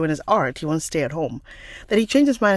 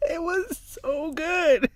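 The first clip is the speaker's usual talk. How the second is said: high, slow, drawn-out delight; sing-song high and lingering over the taste; savouring rave; 11 semitones higher, much slower than usual. Retold or imitated. Raving to a friend about the seaweed, high savouring voice.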